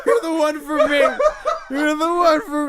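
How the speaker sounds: high, loud, laughing sing-song; laughing mock crooning; mock serenade. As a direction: tease him like a cheesy love song. Friend mocks with sung line.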